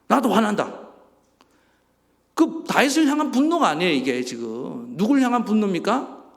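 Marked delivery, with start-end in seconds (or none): 0.1-3.7 louder, higher acted indignation; sympathetic anger. God siding with upset David, acted indignant outburst. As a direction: get cross on someone else's behalf. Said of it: "대신해서 분노해 주는 거예요".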